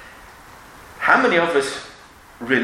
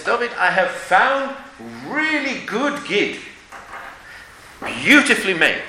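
boastful dealer voice; 5 semitones higher than usual. Retold or imitated. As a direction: proudly show off the goods. Retold dealer boasting about his gid.

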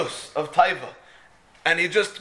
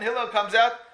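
usual speaking voice

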